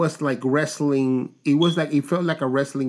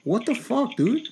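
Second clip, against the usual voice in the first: high, incredulous protest; exasperated; 9 semitones higher than usual. Real, not acted. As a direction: complain in disbelief to the co-host. Protesting at co-host's noisy snack.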